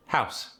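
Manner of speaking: "House" with a crisp, sharp final S. Shown as a demonstration. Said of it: "It's crisper".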